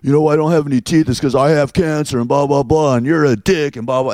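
high, fast, mushy toothless rant; mock him as a toothless man, high and quick; toothless guy ranting. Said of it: "he got in my face and he's like".

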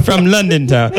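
put-on London accent. Joking about London family roots, in a London accent. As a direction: put on a mock London voice through laughter. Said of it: "not to do the accent".

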